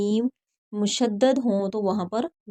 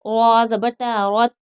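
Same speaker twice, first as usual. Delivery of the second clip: slow, drawn-out letter-by-letter recitation; slow tajweed drill; 5 dB louder, much slower than usual. Shown as a demonstration. Teacher drilling students letter by letter, slow recitation. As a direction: spell and join each syllable like a Quran teacher.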